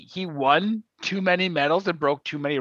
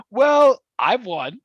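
high, loud smug gloat; 7 semitones higher, 4 dB louder, slower than usual. Retold or imitated. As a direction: gloat over the amateurs. Smug athlete quoted.

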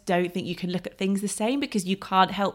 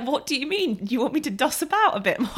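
high, amused disbelief; incredulous retort; 7 semitones higher than usual. Retold or imitated. She answers the locals in amused disbelief. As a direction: ask it like the idea is absurd.

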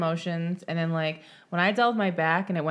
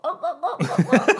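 high squeaky ohs breaking into laughter; quick little gasping moans; high little moaning oh; 11 semitones higher, 4 dB louder than usual. Shown as a demonstration. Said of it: "and I was like, a little".

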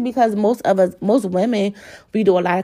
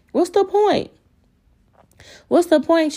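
"What's the point?" thrown way up high in exasperation; worked up; 8 semitones higher than usual. Real, not acted. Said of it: "I'm getting all, like, worked up".

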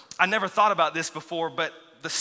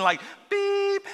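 a high, piercing electronic beep sound; imitated alert beep; 14 semitones higher than usual. Preacher mimics the TV tornado alert, high beep. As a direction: mimic the TV warning tone.

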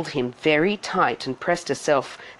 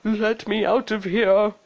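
prince's slightly raised, strained mutter; lightly voiced desperate aside; muttered plea; 5 semitones higher than usual. A narrator's reading. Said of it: "He muttered".

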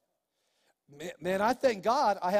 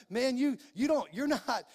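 normal talking voice

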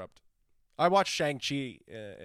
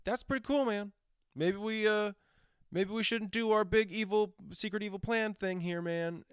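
higher, breezy mock awe; imitating Salma Hayek's character; 9 semitones higher than usual. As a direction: gush about humans like a laid-back alien. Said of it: "Salma Hayek's character's like".

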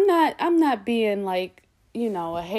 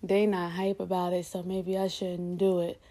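mock discouraged; 4 semitones lower, 8 dB quieter than usual. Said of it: "sit there and be like".

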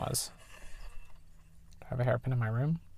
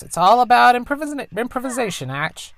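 higher, lightly put-on mother voice; mom's voice; 11 semitones higher, 8 dB louder than usual. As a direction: play the tinkering engineer mom, a bit higher. Voices the mom.

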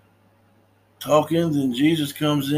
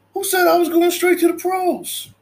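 high, louder mock protest; defensive reply; 3 dB louder than usual. Nephew answering his uncle, high mock protest. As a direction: push back hard.

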